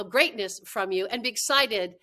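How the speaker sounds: ordinary talk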